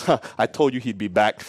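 smug gloating. Retold or imitated. Vendor gloats to his mate.

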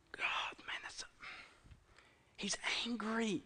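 near-whispered, stunned reaction; hushed dismay. Acted. Preacher reflecting on the older brother's anger, hushed dismay. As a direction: let it out under your breath.

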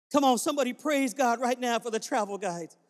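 usual speaking voice

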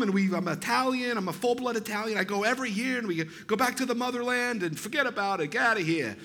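put-on a light Chicago mobster accent, boastful. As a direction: brag like a Chicago wise guy. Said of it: "he sounds like a mobster".

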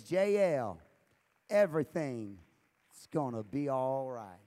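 loud, low-pitched declaration; sign's promise boomed out low; emphatic proclamation. Retold or imitated. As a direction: proclaim each phrase loudly, with weighty pauses. Preacher proclaims the sign's message.

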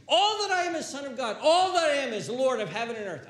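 very high, loud, full-force preaching; rising proclamation. Real, not acted. Preacher proclaiming all Christ gives, at full force. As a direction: build each line to a soaring climax.

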